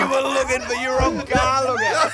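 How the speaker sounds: laughing. Real, not acted. Mocking, laughing at Rog.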